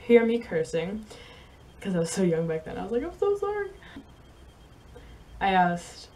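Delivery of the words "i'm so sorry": high apology. Retold or imitated. Apologising to mom after cursing.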